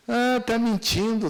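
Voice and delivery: provocação bem aguda e mais alta, arrastada; flagrante brincalhão. Retold or imitated. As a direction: pegue o outro na mentira, cantando. Esposa flagra o marido. (esposa flagrando o marido, aguda e provocadora)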